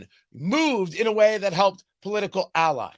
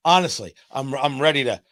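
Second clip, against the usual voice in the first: ordinary delivery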